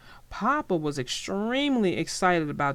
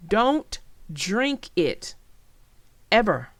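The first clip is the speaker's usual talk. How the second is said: slow, weighty don't drink it, then a louder Ever; public announcement; 3 dB louder, slower than usual. Shown as a demonstration. Host warning kids not to drink brown sink water. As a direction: spell it out like a warning. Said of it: "here's a public announcement for you".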